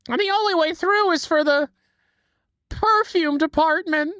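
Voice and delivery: Patrick impression. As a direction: squeak it out like a dopey starfish. Quoting Patrick after Patrick voice.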